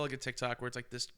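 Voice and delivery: normal talking voice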